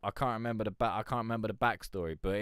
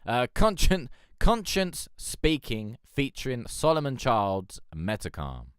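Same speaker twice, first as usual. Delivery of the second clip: louder, slow, higher host read; track announcement; 3 semitones higher, 8 dB louder, slower than usual. Acted. Announcing the next song to the stream, louder and slow.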